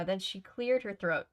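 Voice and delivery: ordinary delivery, nothing marked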